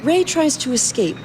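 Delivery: normal talking voice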